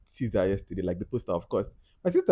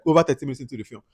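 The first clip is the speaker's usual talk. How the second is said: normal talking voice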